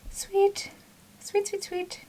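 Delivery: squeaky delighted chant; high-pitched sing-song. Real, not acted. Celebrating, high far voice.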